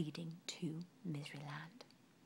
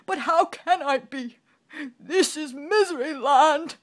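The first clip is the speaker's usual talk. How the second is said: very loud, high tearful wail; king sobs his despair; sobbing; 8 semitones higher, 16 dB louder than usual. Acted. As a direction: wail it with a sob. King crying on.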